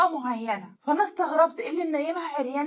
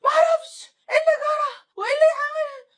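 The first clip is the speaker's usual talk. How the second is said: high, loud tearful wail; mock breakdown; 13 semitones higher, 5 dB louder than usual. Wife breaking down before her husband, hammed-up hysterical crying. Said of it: "انهارت".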